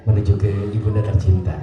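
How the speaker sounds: low, solemn ceremony host; hushed emotion of a sungkem ceremony; moved, sorrowful. Shown as a demonstration. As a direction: invite with tender sadness, pausing between phrases. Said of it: "perlu suatu rasa haru atau sedih ya".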